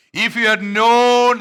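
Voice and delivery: loud, high, slow lament as Jesus; Jesus calling out. Acted. Jesus calling out to Jerusalem, loud slow lament. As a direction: cry it out over the city. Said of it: "he is calling out".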